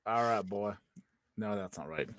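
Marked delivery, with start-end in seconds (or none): 0.1-0.7 a Southern dad, the wrong state; wrong Southern accent. Acted. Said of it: "That's, that's wrong Southern state".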